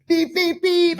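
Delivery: high-pitched imitation of honking; mimicked car horn, high and insistent; car horn beeps. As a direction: beep out short horn blasts. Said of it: "I beeped".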